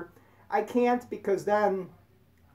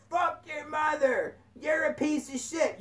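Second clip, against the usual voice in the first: road rage yell; 7 semitones higher, 3 dB quieter than usual. Retold or imitated. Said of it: "if you're having road rage and you're like".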